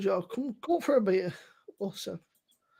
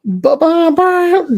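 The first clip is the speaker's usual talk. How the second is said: loud babble; 5 semitones higher, 14 dB louder, much slower than usual. Real, not acted. Drunk call on video chat.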